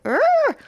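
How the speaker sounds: very high strangled urgh; high frustrated groan. Pretend. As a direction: squeal out an overwhelmed urgh. Overwhelmed by the thought of him.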